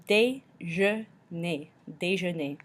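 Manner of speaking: déjeuner slowly sounded out, day-zhuh-nay; slow, syllable-split déjeuner, then joined up; French EU sound. Shown as a demonstration. Teacher sounds out French for breakfast, slow split déjeuner. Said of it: "E U makes an E noise".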